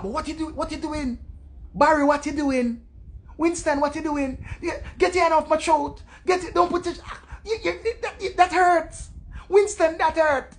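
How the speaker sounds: high-pitched, fussy protest in proper English; mock squeamish woman. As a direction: imitate a dainty lover getting flustered. Mimics stush woman protesting to Winston.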